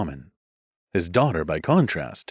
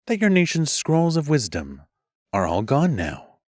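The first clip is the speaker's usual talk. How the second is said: higher, saccharine question; sweet taunt; 7 semitones higher than usual. Acted. Music mage sweetly mocking the lost scrolls. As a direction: fake sweetness hiding a jab. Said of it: "she asked sweetly".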